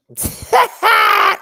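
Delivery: high shrieking burst of laughter; loud shrieking laugh. Real, not acted. Reviewing Raja's Snatch Game, a loud shrieking laugh.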